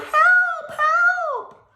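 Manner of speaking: high, loud calls for help; yell for help. Acted. Trapped child pounding on the door calling for help. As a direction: shout desperately for help. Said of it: "I yelled".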